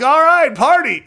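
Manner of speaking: high, loud mock cheer of the brother; sarcastic older brother. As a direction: toss off a scornful "party". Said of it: "So the older brother was like".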